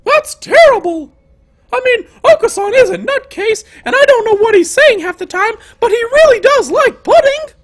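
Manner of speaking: very high-pitched, lively character voice; indignant heroine. Acted. Heroine defending a classmate to Ryuta, high indignant voice.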